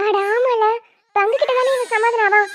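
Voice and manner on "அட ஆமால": normal talking voice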